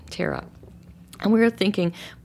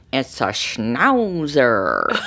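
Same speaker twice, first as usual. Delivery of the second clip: slow, low drawn-out Groucho delivery; Groucho Marx tease; 6 semitones lower, 3 dB louder, much slower than usual. Retold or imitated. Husband teasing wife with the new puppy, in a slow low Groucho voice. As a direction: stretch the word out like a sly comedian. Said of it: "makes this kind of Groucho Marx face".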